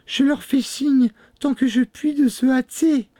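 very high, urgent falsetto; urgent sister. A narrator's reading. Sister signalling the riders, high and urgent. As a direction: call out excitedly in a woman's voice.

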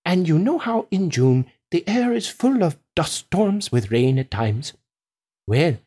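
slightly lilting farmer spinning a tall tale; a hint of a proud farmer; lightly voiced storytelling farmer. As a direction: tell it as a farmer, relishing each detail.

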